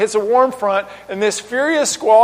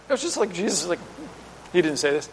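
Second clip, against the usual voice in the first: ordinary talk, nothing marked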